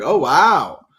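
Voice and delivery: big recalled reaction, swooping up; retold amazement. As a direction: reenact being struck by it. Retelling own stunned reaction to reading.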